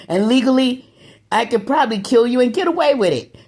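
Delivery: threatening the attackers; menacing warning. Real, not acted. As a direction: warn them coldly, pitch up.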